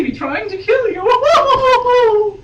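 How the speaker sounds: high, slow, hammed-up doom on "kill you"; melodramatic reveal. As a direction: stretch it out like a soap opera villain. Mock dramatic reading of novel.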